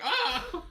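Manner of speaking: sudden high cry of alarm; a quick high yelp of panic; startled yelp. Retold or imitated. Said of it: "you're like".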